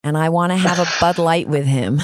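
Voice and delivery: hammed-up American accent, an English speaker's attempt. Shown as a demonstration. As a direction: do a cheesy American test sentence. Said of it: "a test sentence for me that was my American".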